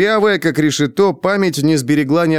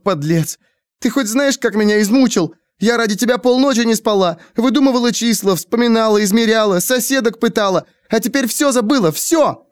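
near-tears outburst; 10 semitones higher than usual. Acted. Dorothy lashes out at Navi, tearful and high. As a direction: rail at him on the edge of tears. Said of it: "чуть не плача, застонала".

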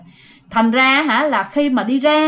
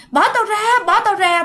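retold desperate yelling; 10 semitones higher than usual. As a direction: shout it like a man being dragged off. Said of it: "mà cứ la vậy đó". (người chồng la làng bị ma kéo, giọng cao và to)

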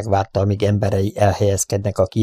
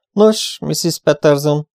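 showy politeness; 7 semitones higher, 3 dB louder, slower than usual. A narrator's reading. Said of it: "tüntető udvariassággal".

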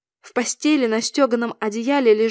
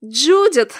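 louder burst, falling away; shrieked name; 2 semitones higher, 6 dB louder than usual. A narrator's reading. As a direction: cry out the name, losing control. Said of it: "визгнула я". (визгливый окрик)

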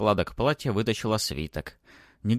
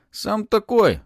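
very high, loud retort; snapping back; 13 semitones higher, 8 dB louder, slower than usual. A narrator's reading. Boy answers the pursuing baron, snapping back. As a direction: throw the insult straight back. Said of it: "огрызнулся Матвей".

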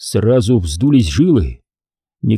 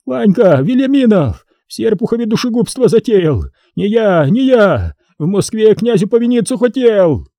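whipped man screaming blame at Ivan; 8 semitones higher than usual. A narrator's reading. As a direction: scream out a name to save yourself. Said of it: "закричал".

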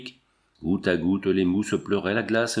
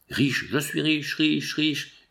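put-on a drunken howl, pitched higher; 5 semitones higher, much slower than usual. The drunk miner howling his luck again, drunken howl. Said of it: "hurla de nouveau Cormac".

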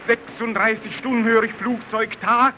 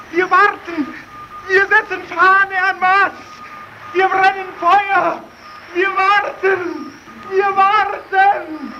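desperate pleading; 8 semitones higher than usual. Acted. Desperate radio call to rescuers.

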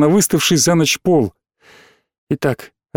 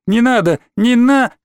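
high cry, cut off mid-word; pleading victim; 8 semitones higher than usual. Acted. Boy stages his own killing, pleading. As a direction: plead high, then break off. Boy fakes being killed.

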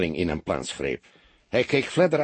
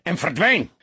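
higher, louder commanding roar; 7 semitones higher, 4 dB louder than usual. A narrator's reading. Senior detective roaring at his young colleague to let go. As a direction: bark the order across the room. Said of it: "brulde hij".

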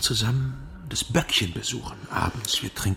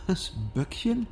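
incredulous; 9 semitones higher, slower than usual. Acted. Incredulous at the nickname.

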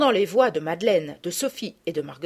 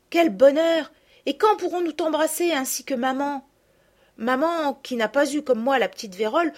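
put-on higher girl's voice telling her news; lightly voiced lively girl; 5 semitones higher, 4 dB louder than usual. A narrator's reading. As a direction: chatter happily. Camille recounts her illness.

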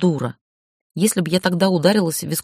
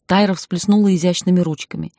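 ordinary delivery, nothing marked

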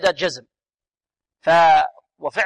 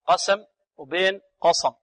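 usual speaking voice

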